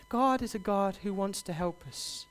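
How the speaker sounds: normal talking voice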